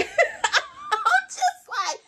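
high squeaky laugh through the words; giggling at herself while she speaks. Real, not acted. Laughing to viewers about her messy plushies.

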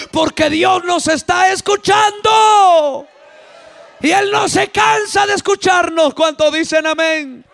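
rhythmic preaching building to a high shout; fervent preaching. Real, not acted.

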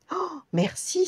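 high, thrilled thank you; delighted cry. Acted. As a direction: gasp out thanks, overjoyed. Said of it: "s'écria le géant".

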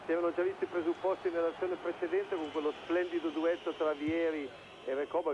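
ordinary talk, nothing marked

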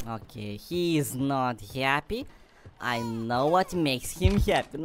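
normal talking voice

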